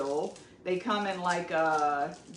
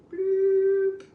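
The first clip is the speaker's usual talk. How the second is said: mock pouring noise; 11 semitones higher, 6 dB louder than usual. Imitating liquid pouring into bowl.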